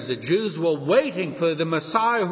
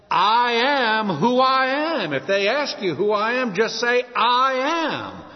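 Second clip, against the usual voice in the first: slow, higher-pitched solemn declaration; God declaring; 5 semitones higher than usual. Retold or imitated. Preacher voices God answering Moses from the fire, declaring. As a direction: proclaim the divine name, drawing out "I am".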